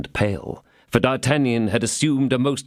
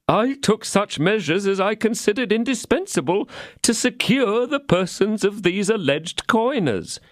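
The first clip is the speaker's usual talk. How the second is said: pleading innkeeper; 11 semitones higher than usual. A narrator's reading. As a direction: beg to be heard. Innkeeper explains.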